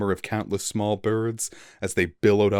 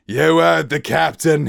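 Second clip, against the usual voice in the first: much higher, much louder shout; bellowed; 9 semitones higher, 8 dB louder than usual. Acted. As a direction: bellow it at the crew. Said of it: "he bellowed".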